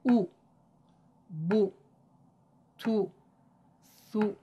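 short clipped Quranic "او، بو، تو، ثو" with rounded lips; Quranic short u syllables. Shown as a demonstration. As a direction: model each syllable crisply for repeating.

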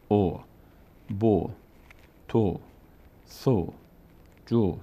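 او، بو، تو، سو، جو each one short rounded syllable, set apart; short damma vowel. Shown as a demonstration. Teacher shows learners how damma sounds on letters, short rounded vowels. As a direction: say او، بو، تو، سو، جو singly with rounded lips and no stretching.

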